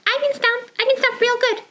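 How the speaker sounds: high proud puppet voice; Trudy puppet voice. Acted. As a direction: boast like a little puppet.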